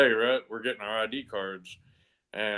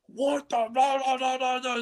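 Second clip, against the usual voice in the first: mock screaming officers; 12 semitones higher than usual. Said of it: "They're just screaming at me".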